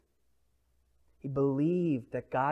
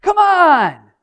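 mock angry shout, much higher and louder; exasperated scolding; 20 dB louder, slower than usual. Preacher mimicking how people scold, hammed-up shout. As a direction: yell it like a fed-up parent. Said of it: "that's what we do, right? Like".

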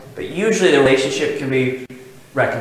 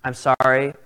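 sincere apology; 5 semitones lower, 4 dB quieter than usual. Retold or imitated. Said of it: "I look at him and I say".

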